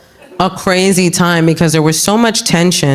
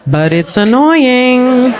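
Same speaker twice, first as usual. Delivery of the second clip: slow drawn-out; 7 semitones higher, much slower than usual. Real, not acted. Drawn-out punchline.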